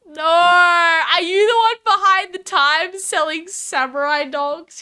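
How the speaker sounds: high, drawn-out mock wail; dismayed outcry. Learning Butts sells samurai dogs, dismayed outcry. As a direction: cry out in hammed-up disbelief.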